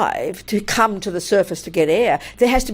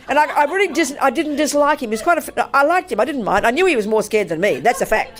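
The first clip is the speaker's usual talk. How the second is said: usual speaking voice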